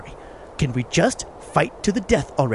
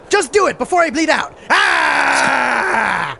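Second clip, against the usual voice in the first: desperate wounded warrior; 5 semitones higher, slower than usual. Acted. Wounded warrior begs to die.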